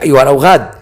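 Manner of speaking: high-pitched, desperate royal command; acted outcry without full volume; mock shout. A narrator's reading. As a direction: call after fleeing men, pitch climbing high. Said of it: "ركض خلفهم وهو يصيح".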